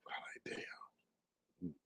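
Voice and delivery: barely voiced, under-the-breath whisper; whispered reaction. Streamer retelling her reaction to Rico, hushed whisper. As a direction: let it out as a hushed aside.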